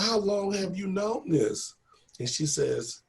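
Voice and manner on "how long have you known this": high, drawn-out retold question; earnest question. Asking Miss Ross one question after calming down, high and slow. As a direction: ask it slowly, pitched well up.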